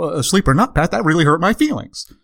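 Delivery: normal talking voice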